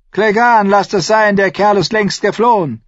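high, loud call to a henchman; urgent shout. A narrator's reading. Someone telling Clegane to stop, shouting. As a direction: call him back over the noise. Calling back guard in tumult.

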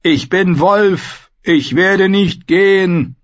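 high, drawn-out wolf-like howl; defiant howl. A narrator's reading. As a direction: cry it out like a howling wolf. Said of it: "hob den Kopf und heulte". (trotziges, langgezogenes Heulen)

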